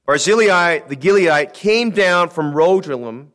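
preacher reading Second Samuel to the church, solemn and loud; scripture reading. Acted. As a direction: read the verses like a pulpit proclamation. Reads scripture aloud in pulpit.

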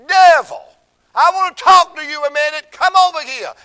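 loud, high shouting dare to the devil; challenging shout. Retold or imitated. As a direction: call the devil out, bellowing. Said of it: "I went around like this".

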